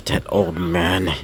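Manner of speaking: low, slow bitter muttering; bitter grumble. A narrator's reading. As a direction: son resenting his father, low and slow. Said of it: "still kind of angry at him".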